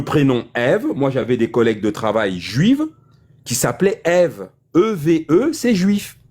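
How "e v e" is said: E V E named letter by letter; E V E, each letter its own syllable; spelled out. Shown as a demonstration. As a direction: spell E V E as three separate French letters. Spells out the name Ève.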